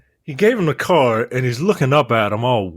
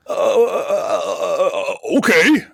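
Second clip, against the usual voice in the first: flustered cave-in; 9 semitones higher, slower than usual. Acted. Flustered lawyer caves in.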